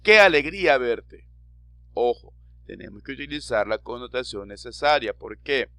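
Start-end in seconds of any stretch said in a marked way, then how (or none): 0.0-1.3 alegre y animado, subiendo el tono; exclámalo con alegría de ver a alguien; exclamación alegre. Shown as a demonstration. (exclamación alegre y animada)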